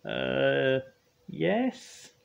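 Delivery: sheepish, high-pitched confession; high, hesitant apology; Spud. A narrator's reading. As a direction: own up reluctantly in a high voice. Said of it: "muttered Spud".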